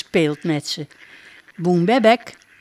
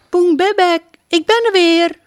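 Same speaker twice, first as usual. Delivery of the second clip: high, louder happy call; 12 semitones higher, 6 dB louder than usual. Acted. Princess calling her duck across the field, high and joyful. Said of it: "roept ze".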